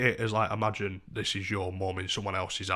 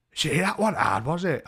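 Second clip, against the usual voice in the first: higher, louder quoted question; imitated drunk woman; 6 semitones higher, 5 dB louder than usual. Woman answering him at his door, imitated. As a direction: mimic her with voice pitched up.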